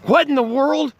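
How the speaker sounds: a hammed-up high gasp of a question; mock surprise. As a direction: fake being spooked for the camera. Staged reaction to Sean appearing.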